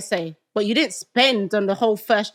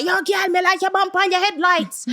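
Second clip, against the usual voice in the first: higher-pitched mock Jamaican catcall; catcalling old man; 7 semitones higher than usual. Woman mimicking old men in Stonebridge, mock catcalling. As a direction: imitate an old man calling out to girls. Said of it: "these old men talking about".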